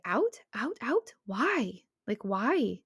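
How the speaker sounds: drawn-out questions leaping up high; imitated high, insistent repeating; dramatic, pitchy. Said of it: "super drawn out and dramatic and, like, pitchy".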